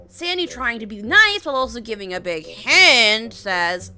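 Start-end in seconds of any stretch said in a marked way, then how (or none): none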